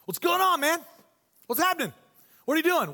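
high, loud, nosy casual voice; pushy buddy Jesus. Retold or imitated. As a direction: get all up in their business, chummy and eager. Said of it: "Let me interpret for you".

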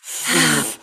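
long calming exhale; slow breath out. Shown as a demonstration. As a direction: breathe out slowly to calm down. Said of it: "let's do some breathing exercises".

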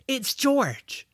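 lightly voiced boy, coaxing and hopeful; George, eager. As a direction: say your name so it understands. George names himself to hatchling.

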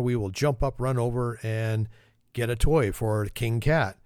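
ordinary talk

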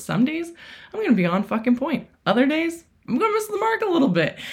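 bright high sing-song inner voice; playful sing-song. Retold or imitated. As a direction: lilt it up like a cheerful pep talk. Voiced hopeful inner story.

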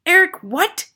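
high, loud incredulous question; explosive headmistress demand; Trunchbull shouting. A narrator's reading. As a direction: shout in disbelief. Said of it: "the Trunchbull shouted".

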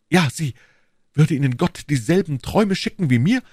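fervent duke pressing his questions; insistent lover. Acted. Duke proving the queen's love to her, fervent. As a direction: argue it ardently, lifting each question. Buckingham insists she loves him.

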